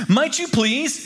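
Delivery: high, drawn-out polite request; cheerful polite plea. A narrator's reading. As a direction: reach up high to stop a passer-by politely. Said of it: "as cheerfully as possible and in my most".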